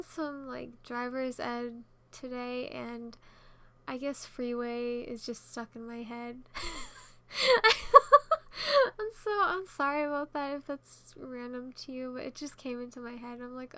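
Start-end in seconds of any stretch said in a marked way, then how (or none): 6.4-8.9 loud, high-pitched laughing fit; burst of laughter. Real, not acted. Vlogger laughing at their own freeway slip, loud burst of laughter. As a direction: crack up loudly, voice shooting up.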